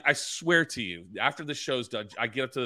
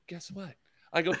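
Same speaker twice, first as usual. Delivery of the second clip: ordinary talk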